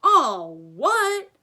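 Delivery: loud, high thundering outburst; booming, incredulous shout; thundered. A narrator's reading. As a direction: thunder it in outraged disbelief. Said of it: "Hagrid thundered".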